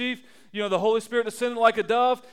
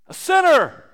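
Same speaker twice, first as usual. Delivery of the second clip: higher, louder punch; exclaiming; 6 semitones higher, 6 dB louder than usual. Real, not acted. Preacher springing the punchline on the congregation, loud. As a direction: hit it as a mock-shocked reveal.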